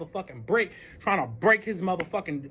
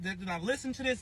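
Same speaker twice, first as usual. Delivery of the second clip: normal talking voice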